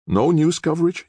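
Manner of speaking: high-pitched, pleading question. A narrator's reading. Nick echoing the attorney's refusal, pleading. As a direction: echo it in high disbelief, pleading. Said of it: "his voice pleading".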